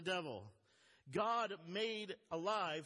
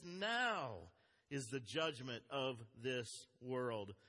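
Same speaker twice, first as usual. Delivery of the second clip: low and slow, each word weighed; solemn slow proclamation; 6 semitones lower, slower than usual. Shown as a demonstration. Preacher reading Jesus's words to the congregation, slow and low. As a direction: deliver it like a verdict on the devil. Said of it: "this dramatic thing".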